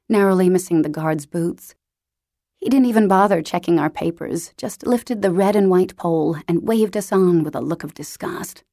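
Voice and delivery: usual speaking voice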